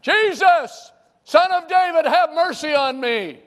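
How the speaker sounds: very high, very loud desperate shout. Acted. Blind Bartimaeus shouting over the crowd to Jesus, frantic. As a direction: scream it over the crowd, begging. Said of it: "all the more he cried".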